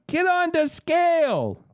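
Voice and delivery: high, drawn-out guard's command; acted guard barking an order. A narrator's reading. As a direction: bark the order high and slow. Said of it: "one of the guards barks".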